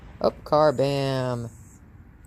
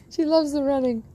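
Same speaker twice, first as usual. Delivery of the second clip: gushing; 12 semitones higher than usual. Real, not acted. Trainer gushing to the camera about her dog, high and delighted. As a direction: gush over the puppy.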